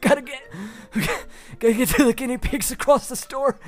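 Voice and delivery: high, harried pet store worker; frantic employee. Acted. The GM voicing a frazzled employee darting past, high and rushed. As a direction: gasp it out like a rushed, overworked clerk.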